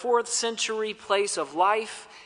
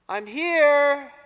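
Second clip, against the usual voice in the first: calling back; 7 semitones higher than usual. Retold or imitated. Answer from fog.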